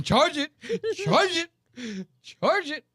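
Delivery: very high, cracked-up imitation; hammed-up, squeaky repeated order; mock instructor order. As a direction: mimic the frantic command through amusement. Laughingly mimics the funniest repeated order.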